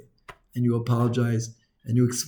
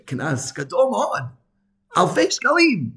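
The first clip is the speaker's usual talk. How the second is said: retold scream; 7 semitones higher, slower than usual. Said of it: "And he was screaming".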